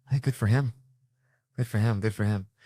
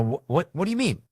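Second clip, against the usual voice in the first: high, stammered disbelief; acted, stuttering puzzlement; mock bafflement; 8 semitones higher than usual. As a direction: stumble into the question, pitched up in mock confusion. Acted baffled reply to gun dealer.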